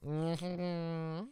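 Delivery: normal talking voice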